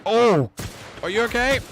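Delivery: high, loud alarmed cry; startled shout. Real, not acted. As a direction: yelp in sudden alarm. Sudden attack during combat.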